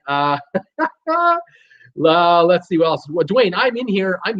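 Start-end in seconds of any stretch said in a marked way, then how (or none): 0.4-1.4 high laugh. Real, not acted. Laughing at silent John.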